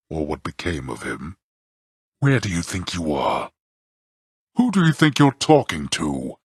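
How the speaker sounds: normal talking voice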